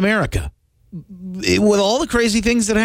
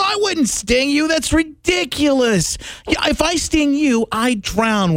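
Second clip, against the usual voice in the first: higher-pitched innocent scorpion voice; scorpion in fable; 9 semitones higher, slower than usual. Retold or imitated. Host voicing the scorpion in the fable, high and protesting. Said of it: "The scorpion says".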